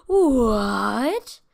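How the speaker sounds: put-on gasping kid's shocked yell; shocked what. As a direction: blurt it out in panic. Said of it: "I was freaking out".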